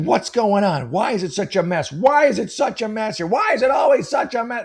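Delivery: a mom at her last straw, yelling at the mess; furious mom. Retold or imitated. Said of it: "So she's just furious".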